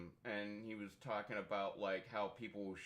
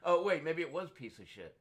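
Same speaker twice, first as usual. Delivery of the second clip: real laughter, louder and higher; laughing; 6 semitones higher, 7 dB louder than usual. Real, not acted. Speaker realizing the acronym, laughing out loud louder. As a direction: laugh through the correction.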